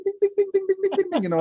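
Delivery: high sing-song ding ding; bell dings. Pretend. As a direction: chime like little bells. Mimicking bells ringing.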